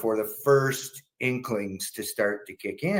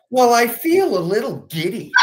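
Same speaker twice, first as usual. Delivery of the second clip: put-on high, louder giggly old lady; giddy mom; 12 semitones higher, 6 dB louder than usual.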